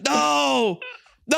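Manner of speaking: drawn-out, high-pitched wail of refusal; mock refusal. Storyteller imitates the kid refusing classmates, high drawn-out wail. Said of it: "And he's like".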